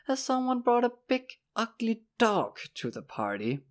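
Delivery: mocking, high-pitched incredulity; mock disbelief. A narrator's reading. Horace insulting Will's pony, in mock disbelief. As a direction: jeer with feigned amazement. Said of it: "he asked in mock disbelief".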